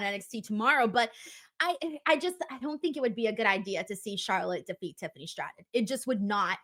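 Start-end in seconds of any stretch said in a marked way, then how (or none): none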